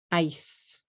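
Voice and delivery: "Ice" as one clean syllable; one-syllable English. Shown as a demonstration. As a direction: say "Ice" in one beat, ending on a bare s. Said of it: "One syllable".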